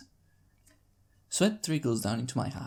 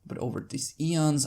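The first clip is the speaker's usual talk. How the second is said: ordinary delivery, nothing marked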